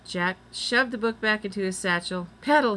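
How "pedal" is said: high, loud command to get going; urgent cry. A narrator's reading. Boy urging his sister to pedal, crying out. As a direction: cry it out to launch the bike. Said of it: "he cried".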